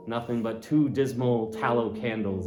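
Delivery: plain reading voice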